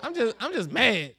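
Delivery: put-on loud, blunt "girl" to his wife; a hammed-up tough-guy brush-off; tough loud retort. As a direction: bark it back at her, half laughing. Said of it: "I told Janita".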